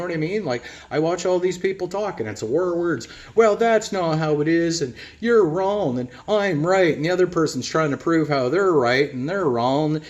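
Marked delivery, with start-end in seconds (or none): 3.3-6.9 mock argument. Mocking a war of words.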